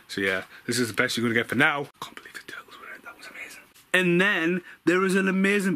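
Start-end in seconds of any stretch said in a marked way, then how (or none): 2.0-3.6 hushed, fast whisper of delight; breathy excited whisper; whispered aside. Real, not acted. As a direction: whisper it like a secret. Excited aside while showing room.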